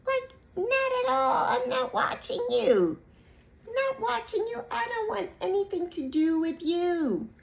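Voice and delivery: high, sassy brush-off; sassy dismissal. Pretend. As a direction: dismiss them with sass. Voicing herself rejecting watcher.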